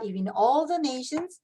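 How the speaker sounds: normal talking voice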